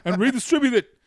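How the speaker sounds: put-on rally the crowd like a fiery old senator; mock campaign-trail indignation; Bernie Sanders. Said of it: "I am Bernie Sanders".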